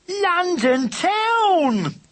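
high, drawn-out cheer; proud shout of their destination; cheering cry. Acted. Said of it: "cried the racers".

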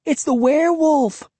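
very high, alarmed cry; frightened girl's shrill outcry. A narrator's reading. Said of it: "Cassie cried".

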